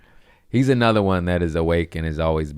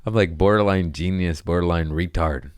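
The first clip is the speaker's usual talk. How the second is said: normal talking voice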